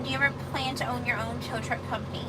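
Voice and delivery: normal talking voice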